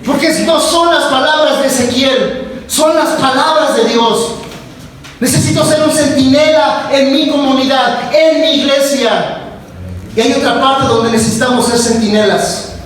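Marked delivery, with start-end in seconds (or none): 0.1-9.4 fiery preacher's proclamation; preaching at full force. Acted. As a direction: proclaim it to the whole church.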